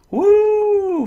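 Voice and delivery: shrill triumphant whoop; high excited whoop. Real, not acted. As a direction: let out a loud high cheer. Survived the kill.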